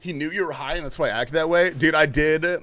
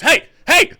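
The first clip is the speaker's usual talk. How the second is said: sudden high, loud yell of "hey"; angry shout; 13 semitones higher, 13 dB louder than usual. Retold or imitated. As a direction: bark it out like an angry old man calling you over. Naked old man accosts him.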